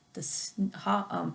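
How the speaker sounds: usual speaking voice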